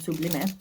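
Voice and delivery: "Sublime" in three Spanish syllables, soo-BLEE-meh; Spanish pronunciation. Shown as a demonstration. Showing viewers how Spanish says it, "Sublime" as soo-BLEE-meh. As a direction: pronounce "Sublime" with Spanish vowels, ending in eh. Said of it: "In Spanish, that's how you say it".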